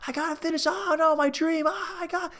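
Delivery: high, wailing crying runner; hammed-up tearful struggle; mock crying. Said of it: "he's, like, crying, he can't even".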